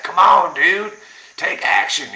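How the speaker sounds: loud Southern guru impression; Southern accent. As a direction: imitate a Southern real estate guru hyping you up. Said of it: "he's got that Southern accent".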